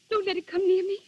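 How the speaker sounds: crying out in fear, words breaking; frightened girl sobbing out a plea; terrified crying. Acted. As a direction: plead through tears to keep her away. Grazia terrified after the fountain.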